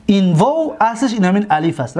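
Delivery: ordinary talk, nothing marked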